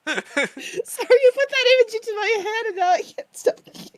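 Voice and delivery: talking through giggles; can barely get the words out for laughing; laughing helplessly while apologising; cracking up. Real, not acted. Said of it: "Cracking up at the idea".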